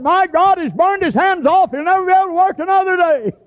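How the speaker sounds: high, shocked exclaim about the burned hands; alarmed cry. Retold or imitated. Onlooker in church shocked by burned hands, high alarmed cry.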